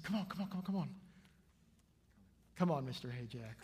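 quick, repeated urging; quick coaxing. Retold or imitated. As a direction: coax a small child along in a hurry. Coaxing the child along.

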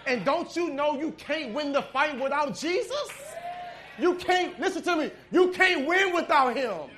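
high, loud, slowed preaching; preaching climax. Acted. Preacher insisting the church can't win without Jesus, loud and slow. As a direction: drive it home to the church.

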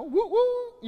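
high, loud whoops; mock celebration. Worshipper whooping over an answered prayer, high and loud. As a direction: cheer like prayer was just answered.

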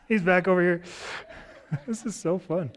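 laughing while talking; chuckling through the words. Real, not acted. Amused watching Bible handout.

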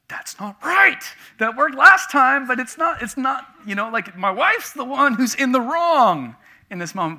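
high, loud inner objection; mock indignant protest. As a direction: protest the unfairness to yourself. His indignant inner protest, acted.

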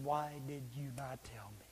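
low, barely voiced plea; whispered reproach. Lost friend to believer at judgment, whispered reproach. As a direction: breathe the question out in a near whisper.

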